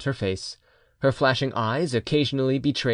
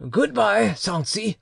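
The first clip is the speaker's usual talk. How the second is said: high-pitched farewell; uncle; 8 semitones higher, slower than usual. A narrator's reading. Uncle tapping the child's cheek, in a high voice. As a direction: pitch up for a honeyed goodbye. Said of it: "said the uncle".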